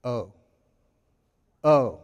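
disappointed oh. Retold or imitated. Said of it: "And they go".

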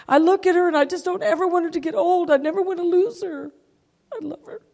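high, breaking into a tearful whisper; real tearful choking up. Real, not acted. Owner fearing to lose her dog, crying. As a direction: lose the voice to tears. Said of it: "Makes me cry when I'm talking".

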